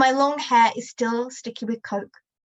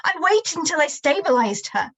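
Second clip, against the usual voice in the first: higher, raised furious voice; mother still shouting; 6 semitones higher, 3 dB louder than usual. Acted. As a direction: keep yelling at him. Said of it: "Mum shouts".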